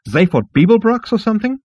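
very high, scornful rising question; flouncing rage. Acted. Outraged insect receptionist mocks the visitor, huffy. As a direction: fling it back indignantly. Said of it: "quivering its wings in rage".

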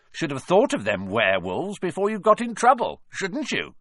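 high gloating sneer; gleeful taunt. Acted. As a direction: rub the boys' noses in it. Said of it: "his voice cracking with glee".

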